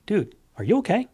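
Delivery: light, high caring question; concerned high check-in. Retold or imitated. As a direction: ask gently, high and open. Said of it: "I'd walk up to him and go".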